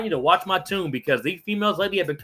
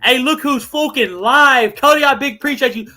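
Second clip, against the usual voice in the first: excited greeting; 9 semitones higher, 8 dB louder than usual. Real, not acted. Greeting viewer in chat excitedly.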